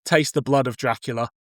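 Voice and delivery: usual speaking voice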